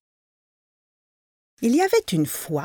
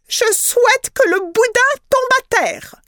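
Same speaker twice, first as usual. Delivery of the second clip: wife's wish; 12 semitones higher than usual. A narrator's reading. Wife makes last wish.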